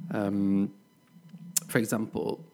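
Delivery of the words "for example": ordinary talk, nothing marked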